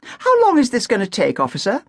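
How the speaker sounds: impatient question. Acted. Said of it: "Agatha asked".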